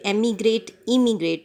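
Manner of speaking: ordinary talk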